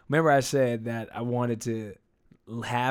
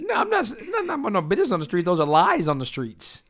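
higher, quick protest; rushed, high-pitched protest; indignant denial; 6 semitones higher than usual. Real, not acted. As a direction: deny it fast, pitch jumping up.

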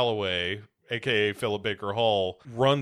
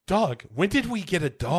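wife voice; 7 semitones higher than usual. Retold or imitated. Wife voiced, far timbre.

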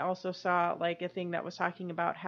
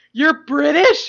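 high, loud disbelieving question; mock surprised question; 7 semitones higher, 13 dB louder, slower than usual. Cohost playing a stunned fan, loud mock disbelief. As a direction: fire it back in startled disbelief.

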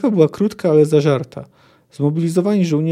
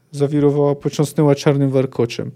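usual speaking voice

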